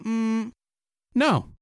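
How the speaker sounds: normal talking voice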